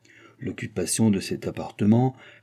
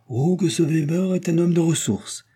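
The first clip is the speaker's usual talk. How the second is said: a touch of delight, pitched up; lightly voiced king pleased with his servant; joyful exclamation; 7 semitones higher than usual. A narrator's reading. King praising Weber to the queen, mildly joyful. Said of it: "avec une exclamation de joie".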